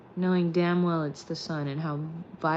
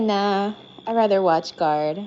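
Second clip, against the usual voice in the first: voicing the dog; 9 dB louder than usual. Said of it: "He's like".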